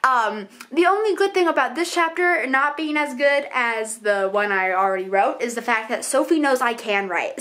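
ordinary delivery

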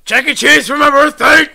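loud high yell; shouting. Real, not acted. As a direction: bellow it at the screen. Shouting spell words at the game.

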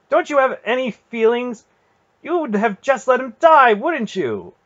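very high, loud outrage; furious accusation. A narrator's reading. Garion challenging his aunt's feelings, furious. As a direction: accuse her at full pitch. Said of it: "He was angrier than he had ever been".

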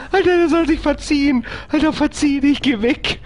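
high whiny almost-crying plea; near tears. Real, not acted. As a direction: beg half sobbing. Android chasing him.

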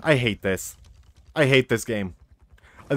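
ordinary talk, nothing marked

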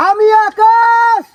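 long drawn-out high shout; shouting to the hills. Real, not acted. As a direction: call it out slowly across the valley. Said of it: "এখন চিল্লাবো".

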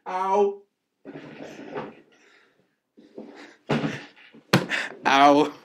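sharp loud "ow" after a fall; real pained yelp. Real, not acted. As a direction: cry out in real pain, loudly. Fell off the bike.

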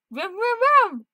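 high, loud vrooming; playful engine noise. Pretend. Revving up for the ride, playful engine noise. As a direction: rev it up like a pretend engine.

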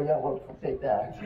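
ordinary talk, nothing marked

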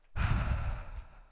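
loud, breathy sigh; heavy sigh. Real, not acted. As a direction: let out a big sigh. Gives up fussing over the build.

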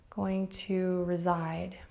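usual speaking voice

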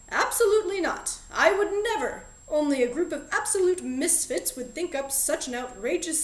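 a shopkeeper loudly denying he suggested a break-in, hammed-up outrage; hasty denial. A narrator's reading. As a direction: protest too much, cutting the kid off. Vernon hastily denies the plan.

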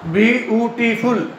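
"ब्यूटीफुल" slowly split into its syllables. Shown as a demonstration. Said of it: "ये एक syllable दो syllable नहीं रहा". ("ब्यूटीफुल" को धीरे-धीरे, एक-एक सिलेबल अलग करके)

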